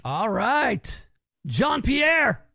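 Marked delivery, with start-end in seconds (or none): 1.5-2.4 calling out. Real, not acted. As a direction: call his name loud and high. Host calling contestant.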